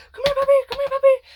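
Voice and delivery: high, loud desperate call to a puppy; high frantic calling of a puppy; urgent puppy call. Retold or imitated. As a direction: yell for the puppy to come now.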